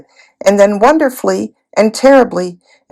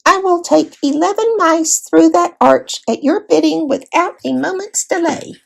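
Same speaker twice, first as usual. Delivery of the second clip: high, small mouse voice; 9 semitones higher than usual. A narrator's reading. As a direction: speak as a brave little mouse, courtly. Reepicheep the mouse speaks.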